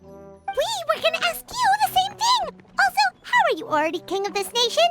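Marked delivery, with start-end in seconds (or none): 0.0-2.6 very high-pitched indignant squeal; high, worked-up exclamation without extra volume; flustered retort. Acted. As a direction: throw the question back, pitched way up. Flustered companion throws question back.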